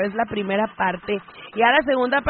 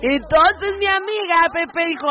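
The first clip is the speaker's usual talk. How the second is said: usual speaking voice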